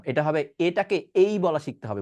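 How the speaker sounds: usual speaking voice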